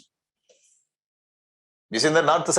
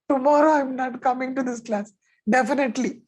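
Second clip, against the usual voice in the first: much higher, playful mock protest; 9 semitones higher than usual. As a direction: squeak it out like a scared student. Joking about quitting the class.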